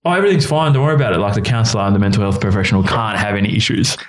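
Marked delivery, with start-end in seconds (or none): 0.0-1.2 high, breezy mock reassurance; faked cheerfulness. Counsellor hiding his troubles from others, falsely cheerful. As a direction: put on a high-pitched everything-is-okay front. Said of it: "I'm really good at faking".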